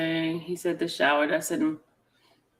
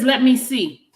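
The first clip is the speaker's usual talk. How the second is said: reading his text higher and louder, a bit hammed-up; mock eager; 5 semitones higher, 6 dB louder than usual. Woman reading a man's text to viewers, higher and louder. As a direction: lift "Let me see" up high and push it louder. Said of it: "Y'all hear, y'all hear that".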